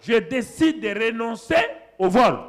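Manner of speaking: loud, rising preacher's cry; preaching at full force. Acted. Pastor thundering at the congregation about stealing. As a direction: drive the warning home to the church.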